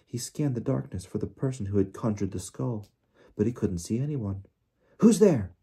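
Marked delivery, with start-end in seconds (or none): 5.0-5.5 loud, high shout; raised, urgent call. A narrator's reading. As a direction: shout the challenge into the dark. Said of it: "he called again".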